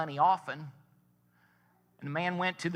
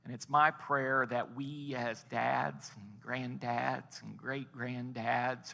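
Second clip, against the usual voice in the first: slow prayer; 3 semitones lower, 4 dB quieter, slower than usual. Real, not acted. Closing prayer.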